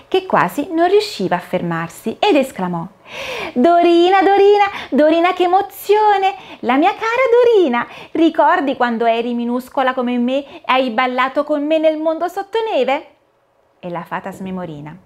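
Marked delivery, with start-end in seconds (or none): 3.5-13.1 high, louder squeaky mouse, bursting with joy; a tiny mouse hopping with delight, calling her name; overjoyed little mouse. Acted. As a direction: Titino the mouse greets Dorina, high and overjoyed. Little mouse greets Dorina joyfully.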